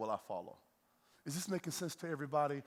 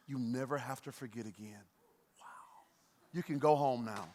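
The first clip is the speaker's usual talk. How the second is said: usual speaking voice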